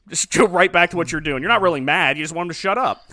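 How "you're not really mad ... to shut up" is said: normal talking voice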